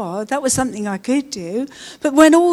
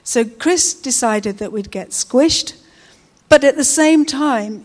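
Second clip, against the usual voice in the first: ordinary talk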